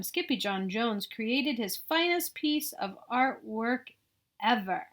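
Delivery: usual speaking voice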